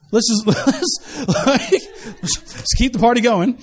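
chuckling through broken, halting words; laughing. Real, not acted. Preacher joking about the repeated servant scheme, laughing. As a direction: crack up while trying to get the joke out.

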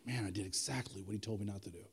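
very low, hushed mumble; acted dismayed realization. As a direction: say it under your breath, sinking with regret. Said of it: "hears the cock crow and goes".

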